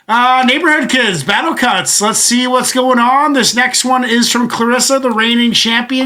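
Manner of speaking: announcer intro. Acted. Host introducing the reigning champion, announcer style. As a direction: introduce the next contender like a show host.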